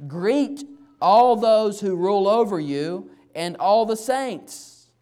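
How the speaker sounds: very loud, high, drawn-out proclamation; preached scripture reading. Acted. Pastor reading the final verse to church, proclaiming at full force. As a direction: ring out the greeting slowly to everyone.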